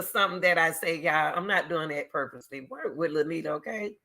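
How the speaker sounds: usual speaking voice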